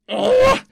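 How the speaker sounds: loud, very high groan of frustration; exasperated groan. Real, not acted. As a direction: let out a loud, high "ugh". Frustrated at not screening post.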